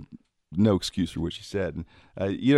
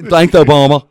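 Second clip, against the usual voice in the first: imitating cousin Victor; 6 semitones higher, 15 dB louder than usual. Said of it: "he does".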